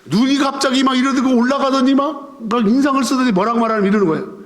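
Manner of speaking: usual speaking voice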